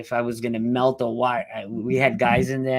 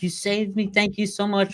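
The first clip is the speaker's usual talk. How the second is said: higher voice, lightly voiced tearful woman; grateful rescued woman; 9 semitones higher than usual. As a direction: imitate the injured woman thanking him, pitched up. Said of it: "She's like".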